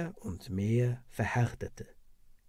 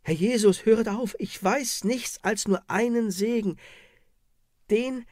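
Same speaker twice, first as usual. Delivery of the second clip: breaking under pain; 6 semitones higher than usual. Acted. Woman breaks under torture.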